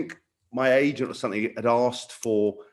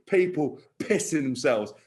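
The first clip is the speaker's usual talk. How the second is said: usual speaking voice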